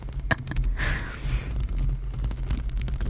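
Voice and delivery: long, breathy, voiceless chuckle; soft laugh. Real, not acted. Amused at being woken by a crab, soft laugh.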